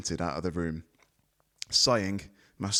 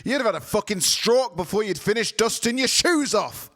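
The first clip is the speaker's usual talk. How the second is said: much higher, louder furious roar; furious shout; 8 dB louder than usual. A narrator's reading. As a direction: slam it home. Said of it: "slammed his hands down".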